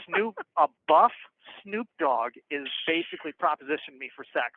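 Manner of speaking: ordinary delivery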